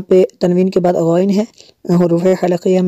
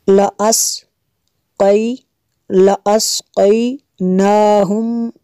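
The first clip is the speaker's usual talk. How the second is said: slow recitation; 3 semitones higher, much slower than usual. Shown as a demonstration. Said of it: "echo recitation drill".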